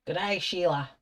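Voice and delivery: badly faked Aussie accent; attempted Australian accent. Painter greeting Sheila for fun, mock Australian accent. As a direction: have a clumsy go at an Australian greeting. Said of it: "trying to do an accent thing".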